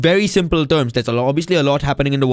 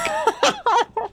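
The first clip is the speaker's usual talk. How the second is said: sudden high-pitched burst of laughter; high squealing laugh; 4 dB quieter than usual. Real, not acted. Host cracks up at co-host, squealing laugh. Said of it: "I'm crying".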